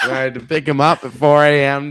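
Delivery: loud laughing words. Real, not acted. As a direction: laugh through the story. Laughing about buddy's DUI.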